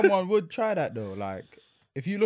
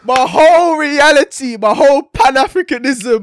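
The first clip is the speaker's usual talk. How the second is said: slow, much louder and higher, leaning on each "whole"; loud emphatic; 6 semitones higher, 13 dB louder than usual. Real, not acted. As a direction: declare it big and loud. Mock outrage about identity.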